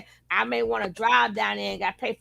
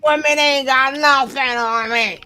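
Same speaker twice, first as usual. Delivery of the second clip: loud playful boast; 4 semitones higher, 9 dB louder than usual. Real, not acted.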